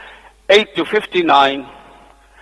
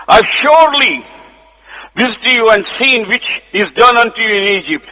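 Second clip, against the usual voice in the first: scripture reading; 2 semitones higher, 4 dB louder than usual. Acted. Preacher reading Moses and God aloud to the church, raised and declaiming. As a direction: proclaim the verses to the congregation.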